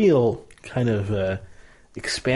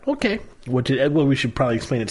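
ordinary talk

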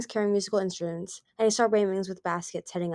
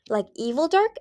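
curious; 7 semitones higher, 5 dB louder than usual. A narrator's reading. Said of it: "an enemy said curiously".

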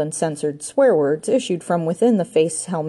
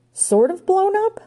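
very high, slow, rising disbelief; aghast echo; 4 dB louder than usual. A narrator's reading. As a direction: stretch it out in high-pitched shock. Ranger appalled by the news.

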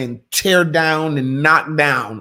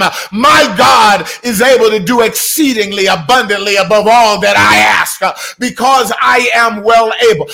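loud, high fervent sermon declaration; preaching at full force; 6 semitones higher, 6 dB louder than usual. Acted. Sermon climax, shouted affirmations.

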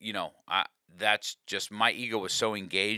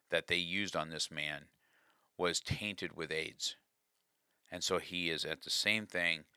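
usual speaking voice

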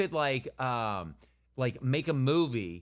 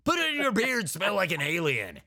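slow commercial announcer voice; hammed-up product pitch, unhurried; mock ad read; 2 semitones higher than usual. Mock ad for beard balm.

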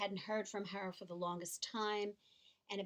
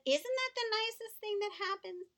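put-on high, bright reply to a child, much higher pitch; delighted exclamation; 11 semitones higher, 4 dB louder than usual. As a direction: gush with delight to a little boy. Teacher's delighted reply, retold.

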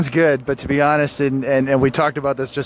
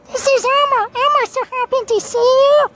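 very high, squeaky puppet voice; Elmo; 5 dB louder than usual. Acted. Narrator on request greeting fans as a puppet, very high happy voice. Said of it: "can you do Elmo?".

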